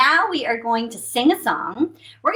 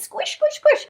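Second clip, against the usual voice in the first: very high, playful squish squish squish; high squishy sound effect. Acted. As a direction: make the water bed squish, way up high. Water bed squishing.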